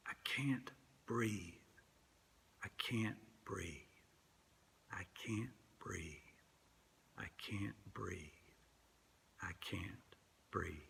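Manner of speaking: breathy whisper dying away to nothing; fading whisper. Retold or imitated. Preacher letting Garner's plea fade out, whispered. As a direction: whisper as if the last air is gone.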